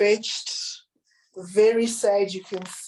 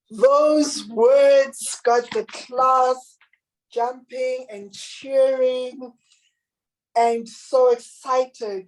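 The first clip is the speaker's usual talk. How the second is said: slow excited narration; 5 semitones higher, 4 dB louder, much slower than usual. A narrator's reading. Narrating the class cheering.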